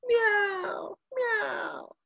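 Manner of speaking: high, mewing cat imitation, fading off; cat meowing. Preacher imitating the prison cat's high meow. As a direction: mew like a small cat outside the cell.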